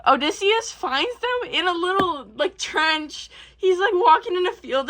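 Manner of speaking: ordinary talk, nothing marked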